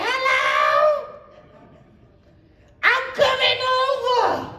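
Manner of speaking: very high squeaky falsetto, desperate woman; shrill over-eager falsetto on the phone. Acted. Ugly woman answers phone.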